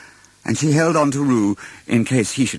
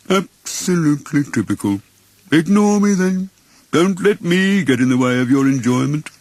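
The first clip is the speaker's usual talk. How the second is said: sulky Eeyore; 5 semitones higher, 3 dB louder than usual. Acted. Eeyore feels ignored, sarcastic and gloomy. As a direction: sulk it out like a slighted donkey.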